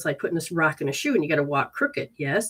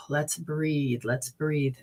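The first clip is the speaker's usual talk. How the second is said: calm breathing cue; 4 semitones lower, 6 dB quieter, much slower than usual. Real, not acted. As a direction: lower and softer, soothing. Calming the client.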